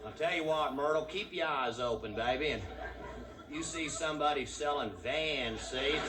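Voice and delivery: country husband talking to Myrtle; drawling Texan van driver. Pretend. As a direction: play the cramped driver griping to his wife.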